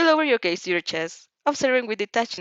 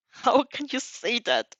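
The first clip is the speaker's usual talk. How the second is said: croaks; 5 semitones higher, slower than usual. A narrator's reading. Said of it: "he croaks".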